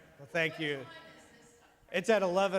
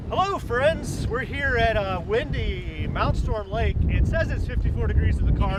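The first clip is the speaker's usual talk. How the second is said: loud over the wind; 3 semitones higher, 8 dB louder, slower than usual. Real, not acted. Outdoor video greeting in wind.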